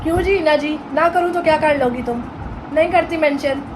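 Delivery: usual speaking voice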